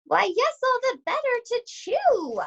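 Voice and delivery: put-on wolf putting on a sugary old lady voice; wolf as sweet granny.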